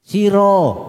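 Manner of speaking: "Siro" called high with a long o; high, falling "Siro" ending in o; rounded ro ending. Shown as a demonstration. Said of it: "baca siro aja".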